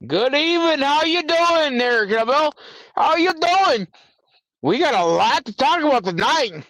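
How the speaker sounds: high, bright host greeting; upbeat greeting. Real, not acted. Streamer greeting Cabell at the show's start. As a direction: welcome them in like a show host.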